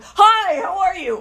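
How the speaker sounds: mock Ted's over-the-top cheer; disgustingly cheerful Ted. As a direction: blast a bright hello at a sleepy guest. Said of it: "he was so disgustingly cheerful in the morning".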